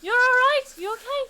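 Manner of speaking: high, loud urgent shouts; alarmed calling. Acted. Somi shaking Kefris awake, shouting high in alarm. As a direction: call him awake in alarm.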